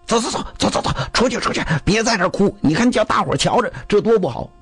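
urging bystanders. Acted. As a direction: shoo him out under your breath. People try dragging him out.

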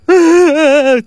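loud, high-pitched put-on sobbing; a burst of stagey crying; mock wailing. A narrator's reading. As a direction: wail theatrically as the weeping woman. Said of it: "继续在那哭".

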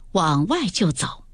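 ordinary delivery, nothing marked

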